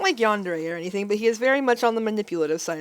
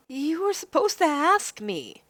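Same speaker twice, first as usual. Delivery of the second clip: higher, slow, indignant; raised heroine voice, defiant; 6 semitones higher, slower than usual. Acted. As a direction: set him straight.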